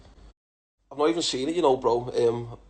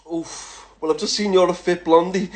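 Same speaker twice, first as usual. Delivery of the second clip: ordinary talk, nothing marked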